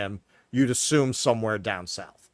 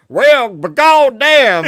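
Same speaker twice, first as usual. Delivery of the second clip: much higher, loud, slow cartoon prospector; Yosemite Sam drawl; 11 semitones higher, 14 dB louder, much slower than usual. Mocking Dylan as a cartoon cowboy, hammed-up drawl. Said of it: "talk like Yosemite Sam".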